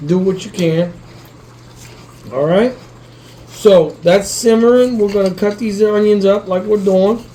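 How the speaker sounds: ordinary delivery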